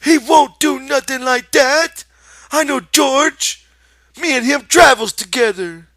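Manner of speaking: cling to it in panic; frantic. Acted. Lenny frantically denies the rabbit, loud desperate. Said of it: "Lenny cried frantically".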